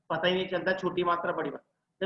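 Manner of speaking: ordinary delivery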